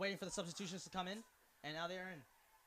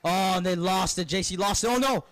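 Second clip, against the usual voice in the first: drawn-out, much louder cry of dismay; 22 dB louder than usual. Real, not acted. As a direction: call out a sudden turnover in dismay. Live call as team loses ball.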